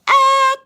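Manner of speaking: high, loud fake scream of being shot; a cartoon yelp when hit; hammed-up shot cry; mock scream. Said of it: "me when I get shot".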